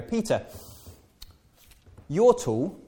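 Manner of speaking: high sing-song hint; butter him up, high and coy; hinting flatterer. Retold or imitated. Churchgoer hinting at Peter, high coy voice. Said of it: "someone will walk up to me and they go".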